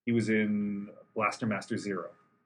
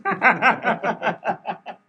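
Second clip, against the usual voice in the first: loud, high cackling laugh; burst of laughter; 12 semitones higher, 10 dB louder than usual. Real, not acted. Co-host cracking up at the podcast comparison, loud laughter. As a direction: crack up loudly.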